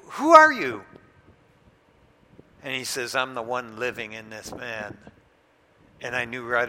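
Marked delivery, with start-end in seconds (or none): 0.1-0.8 higher, loud demand; confronting the demon, loud and high; challenging shout. Retold or imitated. 3.1-5.0 lower, softer demon reply; a hint of a deep demon voice; possessed man answering the preacher, lightly voiced demon. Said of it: "went from regular to real deep".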